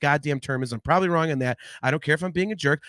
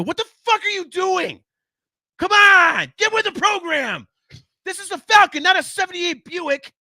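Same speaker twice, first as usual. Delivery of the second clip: high, loud mock rant at a stranger; pretend yelling; 6 dB louder than usual. Fan yelling at the stranger in the copilot seat, high mock shouting. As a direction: yell at the clueless copilot, hammed up. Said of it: "I'm going to yell at somebody".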